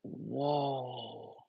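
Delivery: hushed awe. Retold or imitated. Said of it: "you're going".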